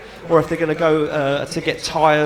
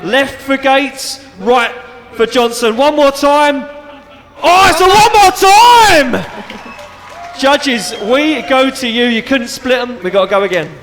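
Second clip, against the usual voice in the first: loud, high excited result call; excited announcer; 8 semitones higher, 9 dB louder, slower than usual. Real, not acted. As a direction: shout it out to the crowd. Live result call, crowd.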